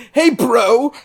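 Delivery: hammed-up bro impression; dude bro voice. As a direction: put on a dopey dude-bro voice. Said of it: "dude bro voice".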